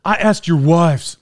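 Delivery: slow, measured retold reply; friend's correction. Friend correcting him about whose favorite cake, slow and pointed. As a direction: lightly voice the friend, spacing out the words. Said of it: "He's like".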